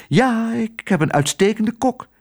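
high-pitched, proud lady's voice. A narrator's reading. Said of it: "zei juffrouw Twiddle trots". (trots, met hoge stem)

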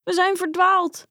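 high-pitched, lightly tearful cry; mild mock wail. A narrator's reading. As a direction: wail it like a lost child, not in full earnest. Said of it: "huilde de andere". (licht gespeeld huilerig, hoog)

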